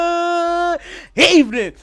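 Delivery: slow, big announcer greeting. Acted. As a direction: open the show grandly. Podcast host opening.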